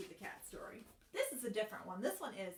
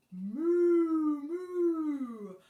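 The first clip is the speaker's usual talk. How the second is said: higher, loud mooing; cow moo; 6 semitones higher, 12 dB louder than usual. Acted. Teacher mooing as the cow in the song. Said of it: "and the cow said".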